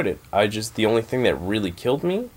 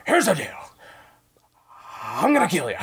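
Al Pacino impression; 10 semitones higher than usual. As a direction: shout it like a scenery-chewing Pacino. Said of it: "He's just Al Pacino".

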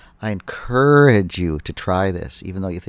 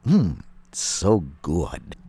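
slow, savoring mmm; appreciative mmm; 2 semitones lower, much slower than usual. Shown as a demonstration. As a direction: draw it out like tasting something delicious. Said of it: "I think that phrase is patented".

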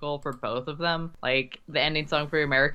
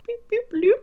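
high bloop sound effect; page turn bloops; 4 dB louder than usual. Acted. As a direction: chirp it like a tape cue.

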